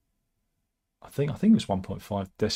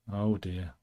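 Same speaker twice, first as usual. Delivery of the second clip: ordinary talk, nothing marked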